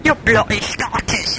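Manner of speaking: usual speaking voice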